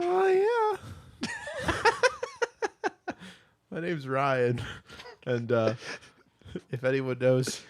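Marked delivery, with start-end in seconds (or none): 0.0-1.7 very high falsetto oh yeah; high moan. Acted. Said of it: "Can I get an "Oh, yeah". 1.8-3.1 very high laughing fit; high laughter. Real, not acted. Laughing after falsetto moan.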